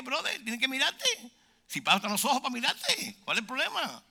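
high-pitched incredulous questioning; comic high exasperation; mock indignant retort. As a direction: challenge them, acting baffled. Acted retort about being stared at.

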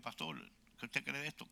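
quietly consult someone you respect; hushed, humble question; deferential asking. Retold or imitated. Acting himself consulting his pastor.

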